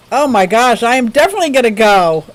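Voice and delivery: ordinary delivery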